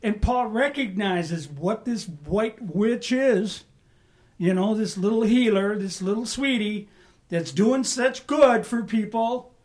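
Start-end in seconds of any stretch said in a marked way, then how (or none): none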